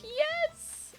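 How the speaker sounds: excited squeal. Real, not acted.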